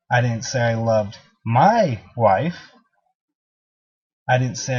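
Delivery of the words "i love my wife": slow down and swoop up on "my"; stress on MY. Shown as a demonstration. Trainer showing how emphasis changes meaning, stress on "my". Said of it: "every time you emphasize on a different word".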